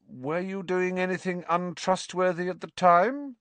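high-pitched suspicious question; mildly probing Hermione; shrewd. A narrator's reading. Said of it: "said Hermione shrewdly".

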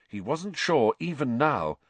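usual speaking voice

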